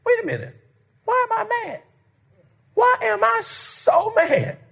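high musing self-talk; self-questioning. Pretend. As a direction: ask yourself, bewildered, why you're mad. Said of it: "try to understand why you're angry".

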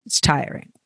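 soft, breathy tired aside; whispered tired comment; tired sigh. Real, not acted. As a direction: let it fade out, worn out. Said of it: "delirious joy is tiring".

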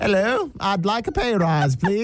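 nervously asking for a pay rise, lightly posh; posh British. Pretend. Nervous worker asking the big boss in mock posh. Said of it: "said to the big boss".

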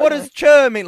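high, loud puzzled question; bewildered, nearly shouting; surprised. Real, not acted. Host reacts to unfamiliar slang.

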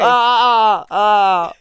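disgusted groan. Real, not acted. Reacting to the taste.

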